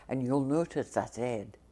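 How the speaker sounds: ordinary talk, nothing marked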